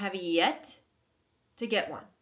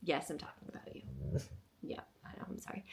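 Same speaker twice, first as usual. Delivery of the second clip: much softer, lower, telling the dog yes; 6 semitones lower, 12 dB quieter, faster than usual. Real, not acted. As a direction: hush it down to the dog. Reassuring the dog softly.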